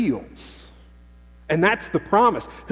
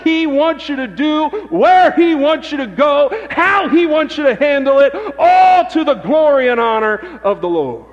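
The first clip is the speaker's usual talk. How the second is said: loud, high, building sermon cadence; fervent pulpit preaching; preaching at full force; 7 semitones higher, 6 dB louder than usual. Acted.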